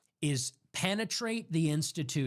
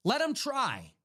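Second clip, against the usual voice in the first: pitched up and punchy; higher defiant repeat; 6 semitones higher than usual. Shown as a demonstration. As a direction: throw the challenge back, higher.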